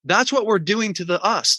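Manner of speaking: normal talking voice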